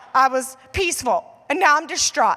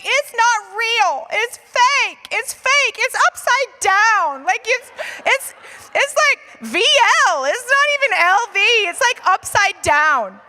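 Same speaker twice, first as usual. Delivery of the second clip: 1 upset insisting; 9 semitones higher, 7 dB louder than usual. Retold or imitated. Insisting to husband bag is fake.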